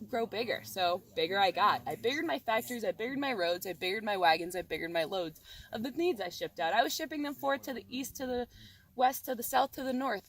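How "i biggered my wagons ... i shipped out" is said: ordinary delivery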